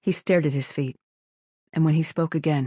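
usual speaking voice

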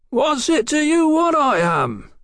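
high, scowling shout; slow, high, truculent holler; angry shout. A narrator's reading. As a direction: shout back defiantly. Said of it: "Mallory shouted".

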